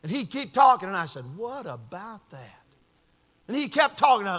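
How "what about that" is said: very soft, slow musing; murmured listening. Retold or imitated. Pastor hears out a troubled man, soft slow musing. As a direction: let it out slowly under the breath.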